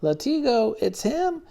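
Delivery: "Latigo" high and loud; stunned realization. Acted. Hero realising the monk is his enemy, stunned and high. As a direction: realise with a jolt who he is.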